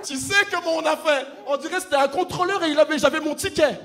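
loud, fast and high; excited loud storytelling; excited shouting. Real, not acted. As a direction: shout it out to the crowd. Excited stage retelling.

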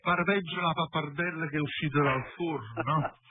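ordinary talk, nothing marked

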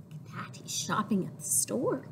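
soft storytime whisper. Acted. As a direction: confide it in a whisper. Whispering aside while reading.

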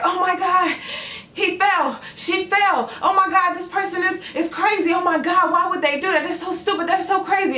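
high-pitched mock panic; onlookers' frantic outcry, hammed up; crowd freaking out. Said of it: "Everyone's freaking out, people are screaming".

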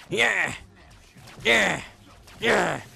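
loud high victory yells; happy scream. Real, not acted. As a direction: scream for joy. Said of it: "spontaneously scream like a pterodactyl when I'm happy".

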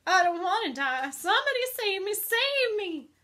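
high, very loud plea; panicked scream. A narrator's reading. Terrified pig begs to be rescued, screaming. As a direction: shriek for help in full panic. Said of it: "screamed Wilbur".

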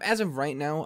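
usual speaking voice

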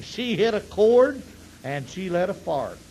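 ordinary talk, nothing marked

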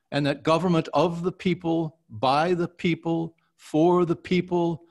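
measured, rising oratory on the people lines; formal speech reading. Acted. Actor reciting the Gettysburg Address for his coach, stately. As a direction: deliver it like a statesman at a podium.

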